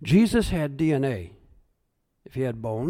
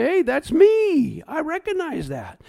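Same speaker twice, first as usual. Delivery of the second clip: much higher, slow amazed exclamation; delighted recognition; 12 semitones higher, 6 dB louder than usual. Pretend. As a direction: gasp at your own face in the mirror. Seeing himself in heavenly mirror.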